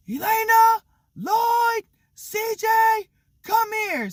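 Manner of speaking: put-on high-pitched holler for the kids; dad yelling. Dad calls his three kids to the kitchen, yelling. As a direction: call out each name high and hard, like summoning them. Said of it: "he never yells".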